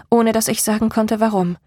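ordinary delivery

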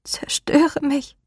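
slow, high, breathless whisper; strangled whisper from the throat; choked whisper. A narrator's reading. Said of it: "die erstickte Stimme einer Frau".

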